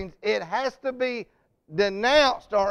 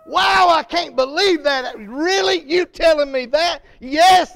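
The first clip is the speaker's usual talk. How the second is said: loud, high, thrilled outburst; excited shout; 5 semitones higher, 7 dB louder than usual. Retold or imitated. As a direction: shout the good news with joy. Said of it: "man, you shout it".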